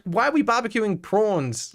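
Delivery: usual speaking voice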